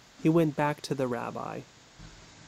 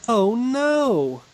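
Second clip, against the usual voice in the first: a loud, much higher wail of dismay; cried out in dismay; 8 semitones higher, 7 dB louder than usual. A narrator's reading. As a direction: cry out in horror as the poor man. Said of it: "cried the man".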